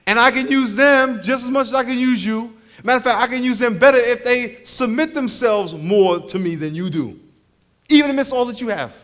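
ordinary talk

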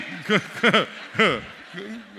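loud real laughter; hearty laugh. Real, not acted. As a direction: laugh out at his own joke. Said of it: "I don't even know why".

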